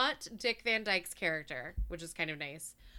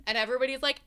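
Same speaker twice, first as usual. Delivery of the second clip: usual speaking voice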